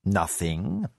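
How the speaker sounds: soft, low questioning echo; mild, almost sorry; regretfully mild. Acted. Spy master echoing the prisoner's denial, soft and regretful. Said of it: "His tone sounded regretfully mild".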